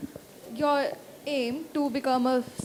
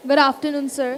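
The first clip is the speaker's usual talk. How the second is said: louder, projected greeting; speaking louder; 9 dB louder than usual. Real, not acted. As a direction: say it up so the back hears. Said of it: "Can you speak louder".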